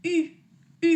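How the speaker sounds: a high French U; U francesa. Shown as a demonstration. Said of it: "la U solita se pronuncia así".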